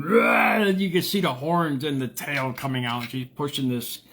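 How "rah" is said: a sudden high, loud mock growl; mock angry roar. Imitating the angry lady at the cart corral with a high, loud roar. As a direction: bark it like a furious devil woman. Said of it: "I saw a lady going".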